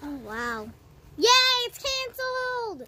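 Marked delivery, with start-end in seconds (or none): 1.2-2.9 higher, slow, louder cheer; drawn-out happy yay. Acted. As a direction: celebrate the news. Cheers at show cancellation.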